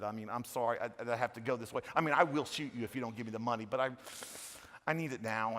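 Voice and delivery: soft, apologetic mugger murmuring his demands; overly polite mugger. Retold or imitated. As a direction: play a mugger who keeps saying sorry, quietly. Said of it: "You don't get mugged like that unless you're in Canada".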